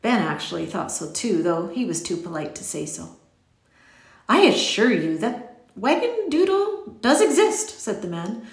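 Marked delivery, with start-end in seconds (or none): 4.3-8.4 storybook eccentric protesting earnestly; insistent stranger. A narrator's reading. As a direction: insist emphatically, stretching the key words.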